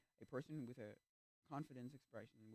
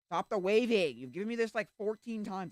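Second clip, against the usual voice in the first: raised voice, high and fed up; exasperated outburst; 6 semitones higher, 8 dB louder than usual. Real, not acted. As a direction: complain loudly in exasperation. Fed up with repeated waving meme.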